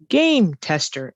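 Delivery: game tester with GAME punched, voice up on game; stress on GAME. Shown as a demonstration. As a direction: hit game, let tester fall. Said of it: "the stress is always on the first word".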